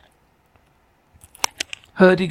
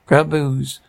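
ordinary delivery

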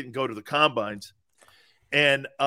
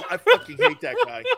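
high, loud, laughing complaint; laughing exasperation; 6 dB louder than usual. Real, not acted. Storyteller fed up with show-off friend, laughing high outburst. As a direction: squeal it through laughter.

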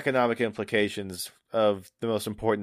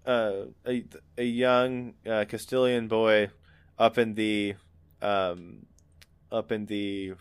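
usual speaking voice

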